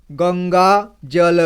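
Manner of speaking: गंगा जल read slowly, joining one syllable at a time. Shown as a demonstration. Teacher showing pupils how to join syllables, slow careful reading. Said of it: "एक एक जोड़ करके इसी तरह से पढ़ना है".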